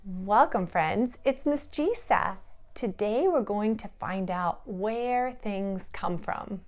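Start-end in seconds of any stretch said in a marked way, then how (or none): none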